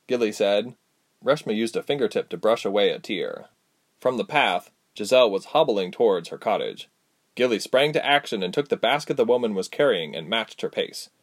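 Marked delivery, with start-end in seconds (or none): none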